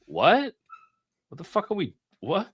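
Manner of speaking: mock bafflement. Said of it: "It's like".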